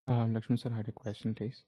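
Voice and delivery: very faint, far from the mic; barely audible. Real, not acted. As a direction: speak so softly it barely carries. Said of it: "Sorry, your voice is very low".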